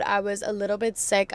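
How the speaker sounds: ordinary delivery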